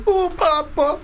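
mock Italian accent. Imitating the comedian's annoying film voice. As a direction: squeal it like a silly cartoon Italian. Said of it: "an annoying voice that he puts on".

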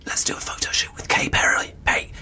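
unvoiced whisper. Real, not acted. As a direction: whisper it conspiratorially. Whispered aside.

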